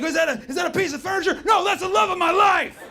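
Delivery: hammed-up shout of surprise, then delight; a hammed-up yell of wonder at finding her; mock surprised shout. Mock delight at his tiny partner.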